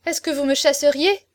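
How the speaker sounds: acted cry of dismay. A narrator's reading. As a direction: exclaim it in alarm, rising at the end. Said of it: "s'écria la pauvre fille".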